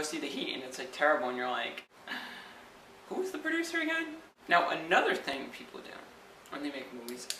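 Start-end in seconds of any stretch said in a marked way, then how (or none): 3.1-4.2 confused viewer. Retold or imitated. Disappointed viewer after bad movie.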